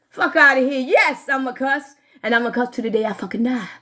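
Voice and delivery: ordinary delivery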